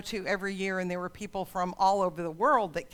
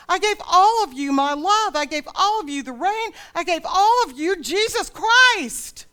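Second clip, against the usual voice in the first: loud, higher, anguished plea, stretched slow in places; God pleading; 9 semitones higher, 9 dB louder than usual. Acted. God begging his people to love each other, loud and anguished. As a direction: cry out to them like a heartbroken father. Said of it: "God's like".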